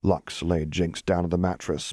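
plain reading voice

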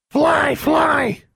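very high, loud squawking cry; bird cry. A narrator's reading. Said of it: "cried Charlie".

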